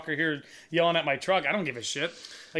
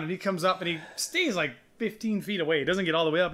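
ordinary talk

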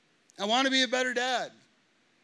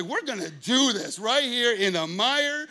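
louder retold comeback, squaring up; mock loud challenge; 6 dB louder than usual. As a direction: throw the dare right back. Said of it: "I'm like".

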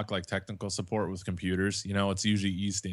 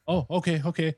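higher-pitched, mildly taken-aback agreement; imitated compliant agent; 7 semitones higher than usual. Support agent agreeing to fetch the manager, mock-meek. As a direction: mimic an agent giving in.

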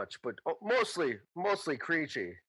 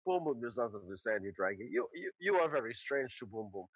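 low, soft simple-creature voice; Boom Boom character; 5 semitones lower, 5 dB quieter than usual. Acted. Boom Boom puzzled by his puppet, low soft character voice. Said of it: "And Boom goes".

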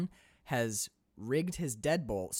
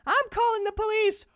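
put-on much higher, louder old lady voice; imitating old woman; 6 dB louder than usual. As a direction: call out like a frail old neighbour. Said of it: "old woman voiced in story".

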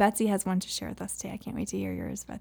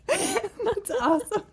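laughing; 14 semitones higher, slower than usual. Real, not acted. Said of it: "laughing at the story".

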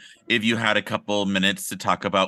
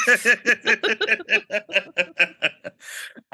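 high witchy laugh; 11 semitones higher than usual. Pretend.

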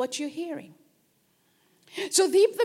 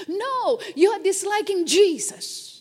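ordinary talk, nothing marked

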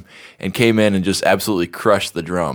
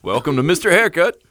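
announcer voice; 9 semitones higher than usual. Pretend. Doing the show intro voice.

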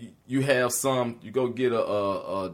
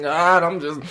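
mock weepy prayer, voice pitched up and louder; tearful prayer; 8 dB louder than usual. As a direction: cry it out to the Lord, hammed-up. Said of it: "you get up in there shedding tears".

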